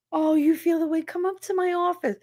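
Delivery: high, syrupy counselor voice; mock sweet counselor. Mocking the school counselor to the audience, in a high sugary voice. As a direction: mimic the coddling counselor with a sugary lilt.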